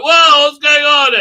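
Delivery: high, loud, slow startled shout; mock surprise. Reviewer mocking Ryan, loud startled shout. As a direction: overplay Ryan's shock. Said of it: "Ryan's like".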